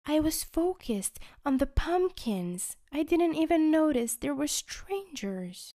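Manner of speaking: put-on high, sweet girl's voice; a dreamy, apologetic reply; candy girl voice. Candy girl explaining to Chubby she was distracted.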